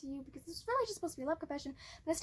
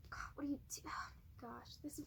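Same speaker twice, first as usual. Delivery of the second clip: breathless flustered whisper; fast, muttered whisper; whispered aside; 2 semitones lower, 12 dB quieter, faster than usual. Real, not acted. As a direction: hiss it quickly under your breath.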